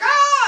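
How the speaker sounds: loud, high-pitched shriek; short piercing mock scream of fright. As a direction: imitate a child's sudden scream. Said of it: "they're, like, screaming".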